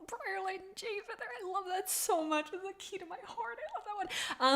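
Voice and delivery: high, soft fond squeal; tender gushing. Real, not acted. Streamer gushing over a favourite cat couple, tenderly. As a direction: melt over it, high and quiet.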